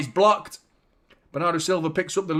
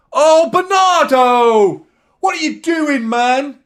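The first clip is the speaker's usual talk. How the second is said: long, high, loud drawn-out cry of disbelief; exasperated shout; 10 semitones higher, 10 dB louder, slower than usual. Real, not acted. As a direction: shout at the player for his foul, stretching out the name. Commentator reacts to a foul.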